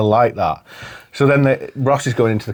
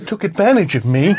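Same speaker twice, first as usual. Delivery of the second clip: laughing quote; 6 semitones higher than usual. Retold or imitated. Friend echoing Chandler's line, laughing. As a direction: repeat it giggling. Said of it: "He says".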